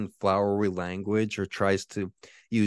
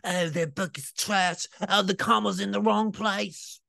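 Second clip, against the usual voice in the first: mocking trolls; 12 semitones higher than usual. Mocking troll streamers trashing his book, high sneer. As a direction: parrot the incel streamers. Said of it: "and they're like".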